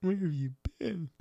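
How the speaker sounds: high, slow cooing to a pet; baby talk to dog. Acted. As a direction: coo it like talking to a puppy. Talking to the dog again.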